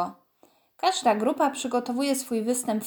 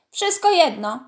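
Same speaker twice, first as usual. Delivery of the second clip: louder, higher irritated huff; huffing angrily; 5 semitones higher, 6 dB louder than usual. A narrator's reading. Baśka huffing at Karol's correction, annoyed. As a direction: snap it off with a huff. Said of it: "sapnęła Baśka".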